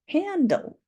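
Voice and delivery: bright confirming high. Shown as a demonstration. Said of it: "Hand on our chin".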